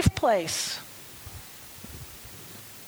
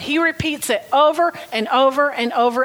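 usual speaking voice

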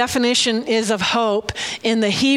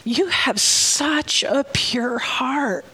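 slow, hushed whisper; tender whisper; 2 semitones higher, much slower than usual. Real, not acted. To Janine about her pure heart, slow tender whisper.

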